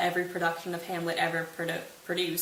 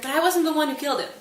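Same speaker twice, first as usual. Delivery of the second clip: high, fast, louder defensive line; hasty denial; 8 semitones higher, 6 dB louder, faster than usual. Retold or imitated. King to Laertes, fast nervous denial. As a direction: blurt out the excuse.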